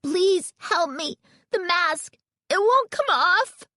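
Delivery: very high, drawn-out panicked pleading; shrill, frightened plea. A narrator's reading. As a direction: beg in rising panic. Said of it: "pleaded in a shrill, frightened voice".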